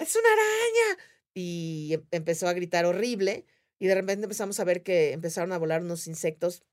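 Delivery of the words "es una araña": very high mock panicked cry; imitated scream. Arachnophobic friend spotting a spider, imitated high scream. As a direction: imitate a terrified spider shriek. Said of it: "la otra empezó a gritar".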